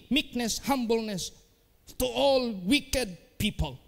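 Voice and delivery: high pleading preacher voice, slowing on the list; earnest pulpit plea; preaching exhortation. Acted. Exhorting congregation.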